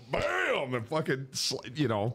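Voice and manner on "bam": higher mock slap; slap sound. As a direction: punch it out. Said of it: "and went".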